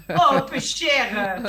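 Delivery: slow laughing southern twang on "peu cher", said "peuchère"; a drawn-out, laughing Provençal "peu cher"; southern French accent. Pretend. Joking with southern friends in a slow, laughing southern accent. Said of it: "je me suis pris pour Mado".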